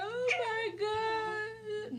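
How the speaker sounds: high, very drawn-out wail; hammed-up crying, stretched out slowly; mock sobbing. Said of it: "I was sobbing everywhere".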